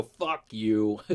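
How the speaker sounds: usual speaking voice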